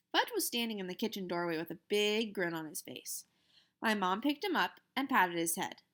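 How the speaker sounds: ordinary delivery, nothing marked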